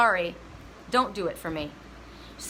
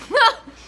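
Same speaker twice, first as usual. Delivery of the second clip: sudden loud, high burst of laughter; 11 semitones higher, 9 dB louder than usual. Real, not acted. Host laughing at her own emotional state, laughing. Outburst after the Pisces moon remark.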